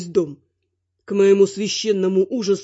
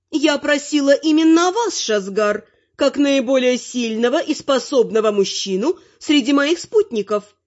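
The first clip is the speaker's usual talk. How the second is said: higher-pitched granny pressing her request; raised, pointed granny voice; commanding granny; 6 semitones higher than usual. A narrator's reading. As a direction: insist in a high old-lady character voice. Narrator voices the grandmother.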